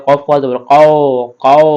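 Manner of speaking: কও said with lips rounded into a circle; rounded mouth. Shown as a demonstration. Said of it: "মুখটা আমাদেরকে গোল করতে হবে".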